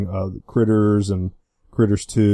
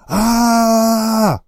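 long stretched "Ah!" held high; drawn-out "Ah"; 14 semitones higher, 5 dB louder than usual. Shown as a demonstration. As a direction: draw the "Ah!" out slowly. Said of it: "That's literally the title of the movie".

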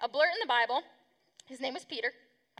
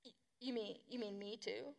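small, soft, lower imitated question; Peter; 3 semitones lower, 15 dB quieter than usual. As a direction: play taken aback, hesitant and hushed. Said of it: "And then Peter's like".

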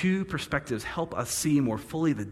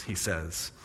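ordinary delivery

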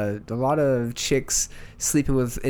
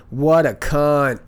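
loud, drawn-out quoted exclamation; imitated podcast opener; 3 semitones higher, 7 dB louder, much slower than usual. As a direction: quote him loudly, stretching each word. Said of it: "He opens up going".

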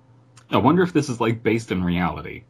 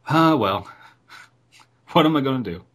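resigned mock shrug; 5 semitones higher, 3 dB louder than usual. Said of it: "it's just like".

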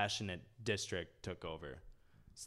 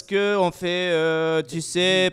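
loud, slow, high mock French rambling; fake foreign language; 9 semitones higher, 14 dB louder than usual. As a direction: ham up a hesitant Frenchman. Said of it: "it's all like".

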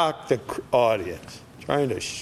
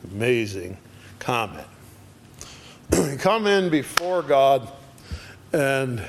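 ordinary talk, nothing marked

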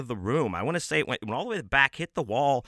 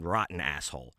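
ordinary talk

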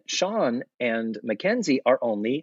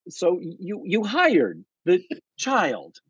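higher, slow disbelieving question; incredulous; 7 semitones higher, much slower than usual. Retold or imitated. Host asking Mrs. Garrett, slow and incredulous. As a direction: drag out the disbelief. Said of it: "And it's like".

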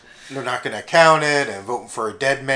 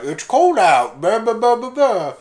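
high, loud crowd chatter; hammed-up old-folks babble; mock old people grumbling; 9 semitones higher, 5 dB louder than usual. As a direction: imitate elderly complaining, climbing into blahs. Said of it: "Everyone's like".

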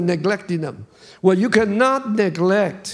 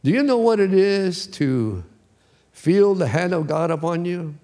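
normal talking voice

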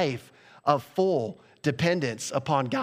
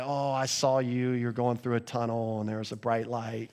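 mock vague prophet; 5 semitones lower, 4 dB quieter than usual. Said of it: "I say that tongue in cheek".

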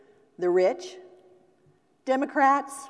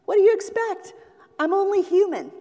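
higher, louder whiny excuse; excusing shortstop; 10 semitones higher, 6 dB louder, faster than usual. Retold or imitated. Shortstop excusing his dropped ball, higher and louder. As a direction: play the shortstop shrugging off his error. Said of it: "and what does he say?".

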